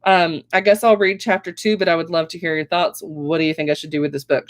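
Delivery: plain reading voice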